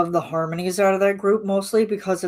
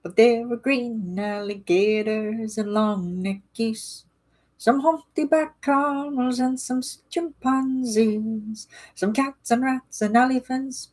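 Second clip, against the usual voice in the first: put-on high, slow singsong Irish accent; terrible Irish accent; 5 semitones higher, slower than usual. Streamer sings Irish Rovers lyric in Irish accent. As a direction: lilt it out in a hammy Irish accent. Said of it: "Sorry for the terrible Irish accent".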